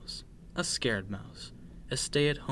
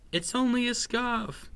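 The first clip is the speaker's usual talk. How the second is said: higher-pitched, chiding sister mouse; dismissive reassurance; 10 semitones higher than usual. A narrator's reading. Sister mouse dismisses her brother's scare, chiding. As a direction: brush off the scare in a raised pitch.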